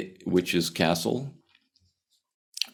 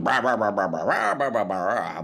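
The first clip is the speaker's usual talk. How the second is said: huffing and puffing; 2 semitones higher than usual. Acted. Exposed guru keeping up the act behind the curtain, huffing bluster. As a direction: imitate a flustered bigwig's empty bluster. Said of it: "he's huffing and puffing".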